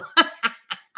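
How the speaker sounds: a high burst of laughter. Real, not acted. Laughing at a viewer's mixup, a high laugh.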